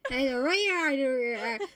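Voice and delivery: put-on copied Devon accent, drawn-out vowels; mock Devonian mumbo-jumbo; Gordon. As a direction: echo the West Country garble. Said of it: "how Gordon speaks".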